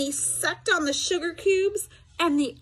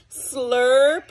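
slurping sound; 7 dB louder than usual. A narrator's reading.

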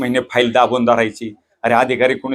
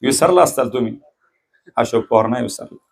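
ordinary talk

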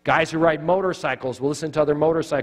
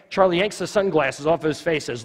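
ordinary delivery

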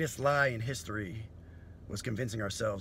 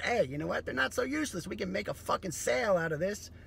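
higher, louder hustler pitch; scheming salesman voice; 8 semitones higher, 4 dB louder than usual. Retold or imitated. Future scavenger pitching skinny jeans, higher hustler voice. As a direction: sell it like a street hustler with a plan. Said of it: "they'll be like".

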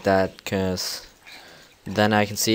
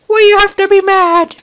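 high whiny complaint; 15 dB louder than usual. Real, not acted. Mob attacking.

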